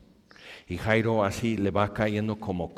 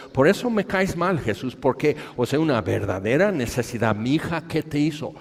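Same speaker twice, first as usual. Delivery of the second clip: louder, higher resentful outburst; angry complaint; 5 semitones higher, 4 dB louder than usual. Acted. Jairo reclamándole a Jesús con enojo. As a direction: throw the grudge at Jesus.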